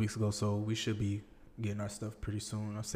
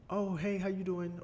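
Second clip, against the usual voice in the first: friendly Southern greeting; 10 semitones higher than usual. Retold or imitated. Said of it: "people, like".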